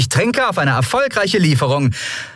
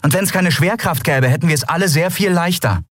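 high-pitched, biting retort; mock the excuse with a venomous lift; venomous sarcasm; 12 semitones higher than usual. A narrator's reading. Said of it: "sagte Endriel giftig".

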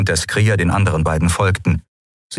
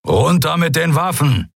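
higher, firm command; commanding order; 8 semitones higher than usual. Acted. Keru ordering the pirates, firm command. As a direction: order them to drop their weapons. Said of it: "befahl Keru".

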